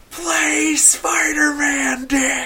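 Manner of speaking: long, high, drawn-out shout at a concert; yelled request. Retold or imitated. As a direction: call it out high and slow across the room. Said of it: "And I just fucking go".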